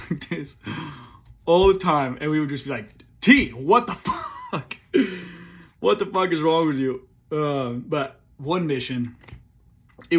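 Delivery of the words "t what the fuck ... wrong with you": mock yell. Squad scolds T's crooked helmet.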